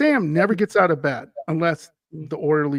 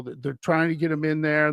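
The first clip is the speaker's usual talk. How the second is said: ordinary talk, nothing marked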